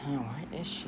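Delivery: soft, hushed wondering; searching to himself. Acted. Boy looking around school for his friend, murmuring. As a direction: murmur it while hunting around. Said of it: "he looks around for Lucy".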